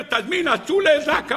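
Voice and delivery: high, frantic reporting voice; agitated servant. Acted. Servant telling his master about revival attempts, agitated. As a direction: keep the alarm up high.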